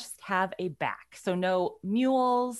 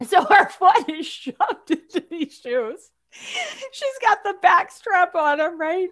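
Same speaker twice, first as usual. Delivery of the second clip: louder, higher, slow words broken by laughter; laughing; 9 semitones higher, 7 dB louder, slower than usual. Real, not acted. Telling the friend about the tight Crocs, laughing hard. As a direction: laugh through it helplessly. Laughing while describing shoes.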